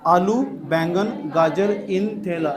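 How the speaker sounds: very slow, stretched-out list; mnemonic drill. Shown as a demonstration. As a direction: stretch each word so the class memorises it. Teacher drills the memory trick. (याद कराने के लिए खींच-खींच कर बोलते हुए)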